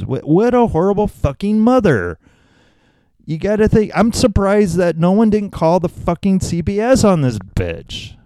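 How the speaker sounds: high-pitched sarcastic indignation; mock outrage. Host pretending a coffee-drinking mother deserves reporting, mock-outraged. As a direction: feign scandal at a terrible mother.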